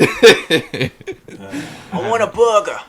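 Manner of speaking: laughing high Boston voice; Boston guy ordering food; Boston accent. Retold or imitated. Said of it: "a Boston accent".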